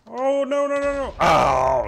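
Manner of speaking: very high, loud alarmed wail; panicked cry. Real, not acted. Sudden danger in the game.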